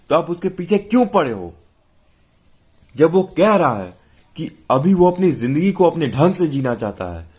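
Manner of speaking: high, heated, emphatic retort; flaring up angrily. A narrator's reading. Wife confronting husband over forcing a marriage on their son, angrily. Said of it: "वो तमक कर बोली".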